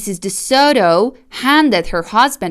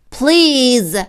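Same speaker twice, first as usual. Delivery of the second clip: higher, drawn-out pleading please; wailing; 4 semitones higher, 3 dB louder than usual. Acted. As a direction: wail it like a hurting animal. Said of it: "the fox wailed".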